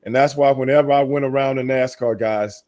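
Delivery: ordinary delivery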